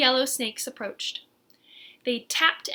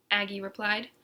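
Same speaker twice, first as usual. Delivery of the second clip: plain reading voice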